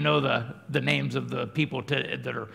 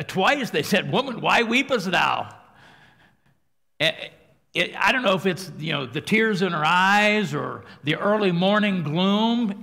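loud, high fervent preaching; 6 semitones higher, 7 dB louder than usual. Real, not acted. Preacher exclaiming to congregation about Mary, loud and fervent. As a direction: preach it with full fire.

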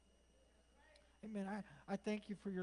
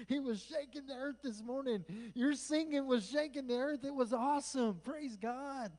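raised pitch, loud and elated; exultant preaching; 6 semitones higher, 6 dB louder than usual. Real, not acted. Preacher praising the church's earth-shaking singing, exultant. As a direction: exclaim it in joyful praise.

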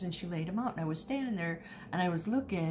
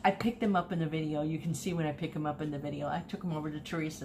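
ordinary talk

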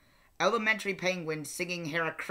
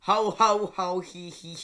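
Joker laugh; 5 dB louder than usual. Acted. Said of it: "laugh like the Joker".

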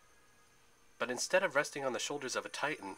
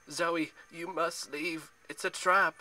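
high-pitched warning, building louder; urgent warning; 6 semitones higher, 5 dB louder, slower than usual. A narrator's reading. Artemis warns Zoe of the trap, urgently. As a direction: strain to warn her off, rising to a cry. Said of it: "Artemis warned".

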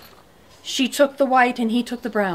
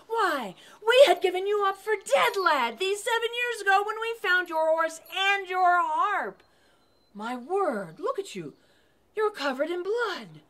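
higher, amazed tavern keeper's voice; astonished innkeeper; 8 semitones higher, 3 dB quieter than usual. Acted. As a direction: greet him like a ghost come home.

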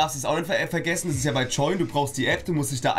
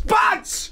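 shouted punch sound. Acted. Punch sound while playing Eli.

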